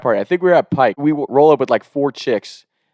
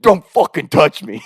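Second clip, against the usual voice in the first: retold angry snap; 5 semitones higher, slower than usual. Angry dad slapping hand away.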